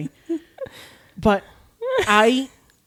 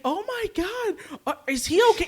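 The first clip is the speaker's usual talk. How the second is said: worried gasp; 11 semitones higher than usual. Retold or imitated. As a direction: mimic a friend's high-pitched concern. Retells friend's worried reaction.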